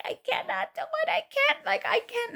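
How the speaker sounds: high, soft despairing wail; mock crying. Woman in labor telling nurses she can't, mock crying. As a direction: whimper in hammed-up despair. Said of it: "ladies are screaming and crying".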